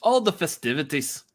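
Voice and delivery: put-on hammy Irish accent that drifts Scottish. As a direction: lilt it like a stage Irishman. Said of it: "my Irish sounds Scottish".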